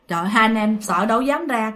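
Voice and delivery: plain reading voice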